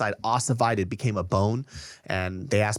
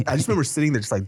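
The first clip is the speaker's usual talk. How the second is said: ordinary talk, nothing marked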